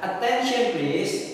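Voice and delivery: slow, drawn-out "Attention please" with a high start; teacher's slow drawn-out demo. Shown as a demonstration. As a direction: model "Attention please" slowly for the class.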